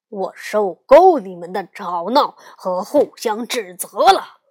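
very loud acted roar of a father; angry roar. A narrator's reading. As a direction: bellow like a papa bear at the end of his patience. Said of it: "熊爸爸大吼着". (熊爸爸忍无可忍地大吼)

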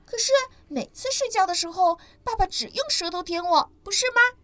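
boastful little ankylosaur; smug baby dinosaur. Acted. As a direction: prove you're the favourite.

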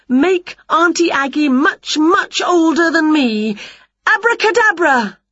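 high, forceful incantation; spell-casting. A narrator's reading. Winnie wishes with all her might, high incantation.

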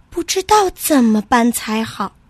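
ordinary delivery

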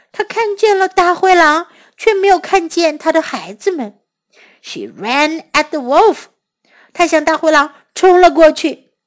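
plain reading voice

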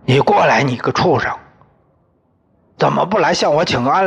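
father-in-law shouting down the disgraced son-in-law; furious shouting. Acted. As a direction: rant at him with scorn. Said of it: "挥着手向我喊".

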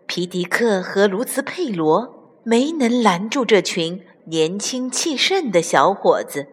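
plain reading voice

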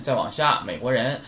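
ordinary delivery

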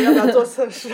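laughing at her own tearful question; real laugh while retelling it; mocking herself with a laugh. Real, not acted. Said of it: "我一边哭一边还记得说".

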